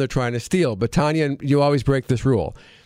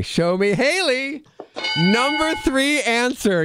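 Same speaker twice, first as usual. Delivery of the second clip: game show host; 7 semitones higher, slower than usual. Acted. Game show host reveals answer.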